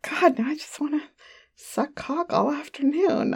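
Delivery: soft sultry whisper; breathy whisper. Real, not acted. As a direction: whisper it like a secret craving. Sultry aside to listeners.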